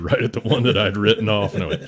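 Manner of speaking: normal talking voice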